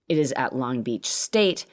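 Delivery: usual speaking voice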